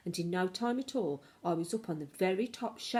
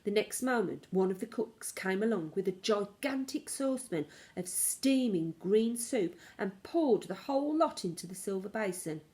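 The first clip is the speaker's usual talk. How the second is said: plain reading voice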